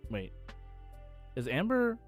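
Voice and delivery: ordinary talk, nothing marked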